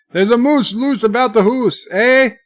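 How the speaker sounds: high, loud mock Canadian accent; hammed-up Canadian. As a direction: belt out "hoose" and "eh" in cartoon Canadian. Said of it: "that was my Canadian".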